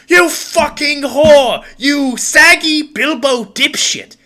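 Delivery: slow, high screeching insult; loud, drawn-out, high-pitched screech; screeched yelling. Acted. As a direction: shriek it like a spouse mid-brawl. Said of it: "loud yelling sounds and, like, screeches".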